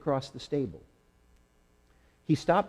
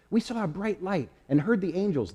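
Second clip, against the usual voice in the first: higher, eager burst of news; excited shepherds; 8 semitones higher than usual. Acted. Shepherds rush in with news of angels, excited. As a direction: blurt it out, full of wonder. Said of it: "they were excited".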